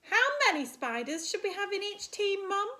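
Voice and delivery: high, quick small-spider voice; eager little spider asking Mum. Acted. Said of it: "asked Spinderella".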